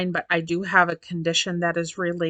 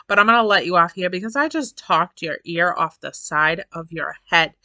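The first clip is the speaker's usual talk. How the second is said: normal talking voice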